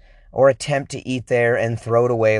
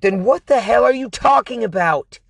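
angry; 8 semitones higher than usual. Retold or imitated. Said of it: "I'm like".

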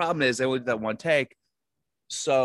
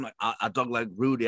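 ordinary talk

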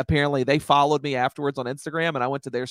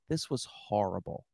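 low, slow, quiet deadpan; deadpan blunt; 6 semitones lower, 12 dB quieter than usual. Retold or imitated. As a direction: drop it flat and cold, dead honest. Bluntly telling fan the truth.

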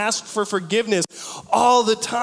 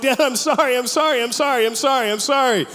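put-on pleading kid; 6 semitones higher than usual. Kid apologizing to angry dad.

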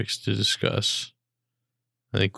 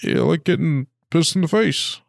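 Obama impression; 6 semitones higher than usual. Mocking Obama taunting Trump about the Lego tower. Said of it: "my Obama impression".

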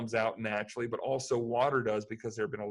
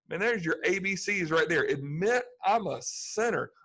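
normal talking voice

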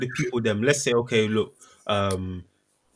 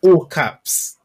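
emphatic loud; 7 semitones higher, 11 dB louder than usual. Acted. Stressing the email is in capitals.

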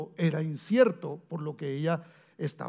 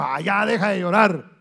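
louder, brusque, faster brush-off; mock impatient dismissal; 3 semitones higher, 9 dB louder than usual. How Jesus did not speak to the widow, brusque and loud. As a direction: scold them to quit crying, hurried and impatient. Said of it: "no llegó y le dijo".

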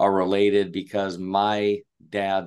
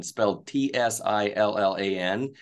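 normal talking voice

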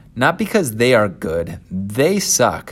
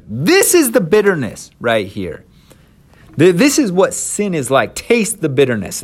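loud, high, impassioned outburst; angry Moses; 7 semitones higher, 4 dB louder than usual. Acted. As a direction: thrust it at them in a storm of rage. Acting out Moses's rage.

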